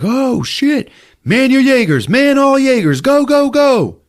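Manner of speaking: very high, loud urgent yells; rally the pilots in a panic; alarmed shouting. Retold or imitated. Cadets yelling to man the Jaegers, very high and loud.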